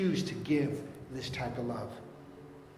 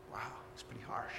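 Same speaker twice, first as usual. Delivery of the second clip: whispered aside; 5 semitones lower, 17 dB quieter than usual. Real, not acted. Aside to congregation after harsh quote.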